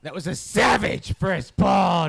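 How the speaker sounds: booming, slow and loud with venom; real power. Acted. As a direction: slam the line out like a big hit. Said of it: "give me a start with real power, real venom".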